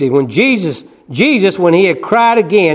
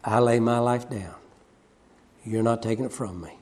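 much softer, low firm words; quiet resolve; 5 semitones lower, 11 dB quieter than usual. Retold or imitated. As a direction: say it quietly, sure of yourself. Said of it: "He said".

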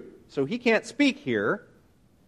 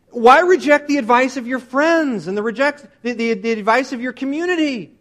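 raised, exasperated challenge; indignant protest; 6 semitones higher, 3 dB louder than usual. Retold or imitated. Relatives question mother rejecting their advice, high and indignant. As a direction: challenge them as an affronted relative.

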